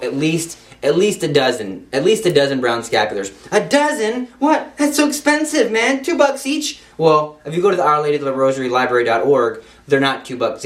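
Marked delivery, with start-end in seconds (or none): 3.5-6.8 much higher squeaky voice, incredulous; mock shocked listener. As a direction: play the stunned penny-pincher, pitched way up.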